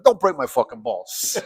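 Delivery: high-pitched New York tough-guy gripe; mock Italian-American New York accent. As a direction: gripe like a Sopranos New Yorker. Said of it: "living in New York".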